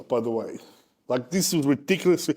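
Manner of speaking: ordinary talk, nothing marked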